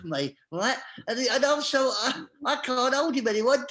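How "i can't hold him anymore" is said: ordinary talk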